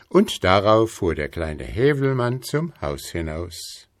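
ordinary delivery, nothing marked

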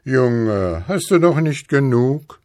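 a hint of an old Moon reproving a child; guter alter Mond. A narrator's reading. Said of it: "sagte der gute alte Mond". (leicht gespielter alter Mond, mahnend fragend)

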